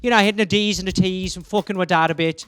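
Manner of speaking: chatty Irish pub-talk accent. Shown as a demonstration. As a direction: hit the D's and T's in Irish. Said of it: "obviously it's fun to be Irish".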